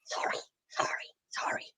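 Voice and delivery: breathy whispered apologies; whispered sorry. Acted. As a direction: whisper the sorries sheepishly. Puppet whispers apology after being scolded.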